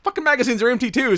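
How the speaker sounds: fast, high, loud mock yell; exasperated shouting. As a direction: holler back in mock frustration. Acted exasperated gunfight exchange.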